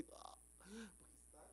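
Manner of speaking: faint, airless whisper of a suffocating man; breathless demo. Shown as a demonstration. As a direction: show how breath runs out on the cross. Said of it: "respira assim".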